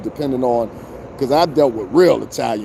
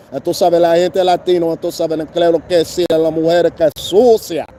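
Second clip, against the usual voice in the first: mock Spanish; 4 semitones higher, 4 dB louder than usual. Mocking tourists with loud broken Spanish. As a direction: ham up street Spanish, louder.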